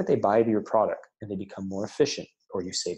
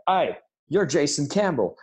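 higher-pitched, poorly faked Australian accent; attempted Australian accent; 6 semitones higher, 3 dB louder than usual. Woman greeting him at a closing party, attempted Australian accent. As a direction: put on an amateur Australian accent. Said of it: "with her Australian accent".

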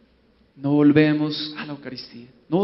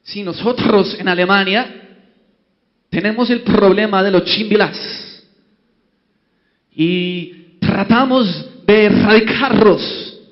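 put-on loud, stiff German-accented Spanish; German bishop declaring his problem in a heavy German accent; 7 dB louder, slower than usual. German bishop telling the other bishops, loud German accent. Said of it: "el obispo alemán empezó".

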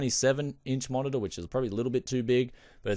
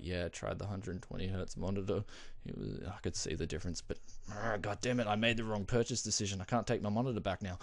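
mock regretful confession; 2 semitones lower, 7 dB quieter than usual. Jealous friend quietly admitting he saw the difference. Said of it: "He's like".

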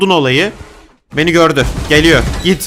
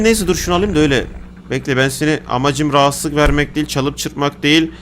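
ordinary talk

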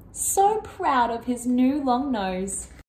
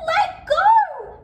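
high, loud elephant squeal; acted high-pitched, urgent cry; 6 dB louder than usual. A narrator's reading. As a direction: squeal in alarm to be released. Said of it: "squealed Elephant".

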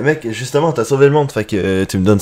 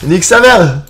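ordinary delivery

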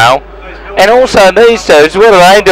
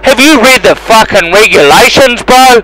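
angry rant; 8 semitones higher than usual. Real, not acted. Pilot yelling at another plane blocking his runway, exasperated and angry.

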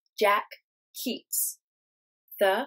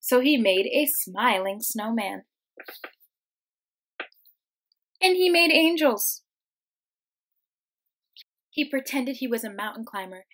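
put-on lively, expressive read-aloud for kids; reading to children; 6 dB louder than usual. Teacher reading a picture book to her class, lively storytime voice.

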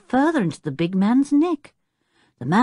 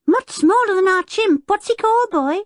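put-on high, loud child's voice; high-pitched boyish curiosity; excited small boy; 4 dB louder than usual. A narrator's reading. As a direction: chatter eagerly like a little boy. Excited small boy questioning Tinker.

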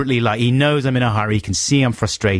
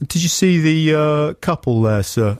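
higher, slow patronising question; smug cop voice; 6 semitones higher, slower than usual. Retold or imitated. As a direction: lead the cyclist on like a pleased officer. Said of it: "He's like".

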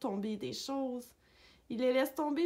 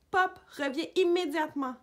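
higher, louder command; stern order; 5 semitones higher, 5 dB louder than usual. A narrator's reading. Owner orders the dog out of the water, raised commanding voice. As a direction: order the dog back firmly.

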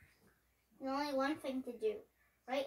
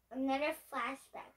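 ordinary delivery, nothing marked

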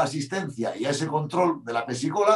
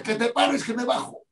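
retold angry demand; 8 semitones higher than usual. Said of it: "Pero repetía".

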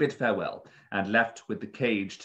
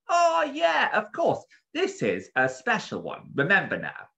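protective bird keeper; 12 semitones higher, 5 dB louder than usual. A narrator's reading. Bird keeper handing over a special parrot, eagerly. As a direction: voice an eager, fussy bird seller.

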